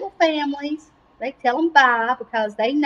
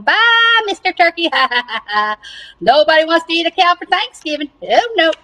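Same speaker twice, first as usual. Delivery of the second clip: higher gloating with a mock laugh; laughing taunt; 4 semitones higher, 3 dB louder than usual. Cow laughing at the turkey, higher mocking taunt. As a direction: tease in a raised, gleeful voice. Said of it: "still over here laughing".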